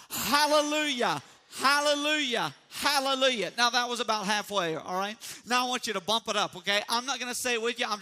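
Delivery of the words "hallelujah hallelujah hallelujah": hands cupped round the mouth, calling out loud; loud through cupped hands. Shown as a demonstration. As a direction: shout it through your hands. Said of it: "hands up over your mouth like this".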